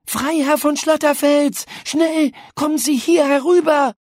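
high, loud call across the garden; urgent call. A narrator's reading. Paula calling the ghost over from behind the tree, urgent high call. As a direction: call him over, quick.

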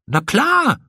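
high, cocksure reply; breezy confidence. A narrator's reading. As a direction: answer as if there's no doubt at all. Cocky builder reassures worried friend.

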